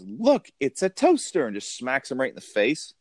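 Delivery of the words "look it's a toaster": high, slow sweet fairy voice; Carol Kane line. Retold or imitated. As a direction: chirp it brightly like a cheery fairy. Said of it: "Carol Kane goes".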